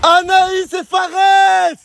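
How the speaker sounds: drawn-out shout. Acted. Host calls out the vote.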